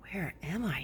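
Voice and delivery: soft unvoiced whisper. A narrator's reading. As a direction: breathe it out, lost and weak. Owl wonders, whispered.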